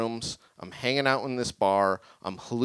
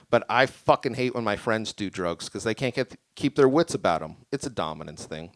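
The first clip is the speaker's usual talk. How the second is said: ordinary delivery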